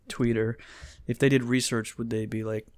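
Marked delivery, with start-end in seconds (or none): none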